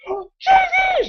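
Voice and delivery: very high squeal of recognition; mock damsel crying out to her lover; imitated overjoyed woman. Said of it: "And she's like".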